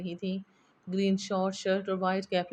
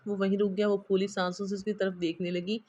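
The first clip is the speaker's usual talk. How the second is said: plain reading voice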